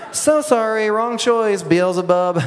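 high, hammed-up gloating; mock-sympathy taunt. Believer tells the devil he chose wrong, mock-sympathy taunt. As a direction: jeer playfully, starting high and sliding down.

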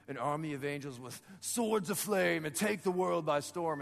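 mock-epic battle pitch; overexcited angel proposing conquest; excited Gabriel. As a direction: build to a grand war cry. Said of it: "I get excited".

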